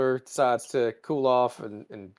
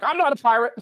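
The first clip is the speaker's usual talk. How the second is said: higher, louder mock outrage; indignant protest; 11 semitones higher, 5 dB louder, faster than usual. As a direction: insist hotly, hammed up. The Orion protests his innocence.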